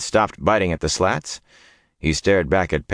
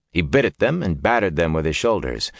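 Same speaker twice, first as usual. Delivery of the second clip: usual speaking voice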